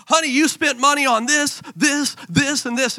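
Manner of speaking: high-pitched mock indignation; mock accusing. Spouse challenging partner over spending, accusing.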